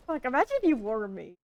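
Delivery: ordinary talk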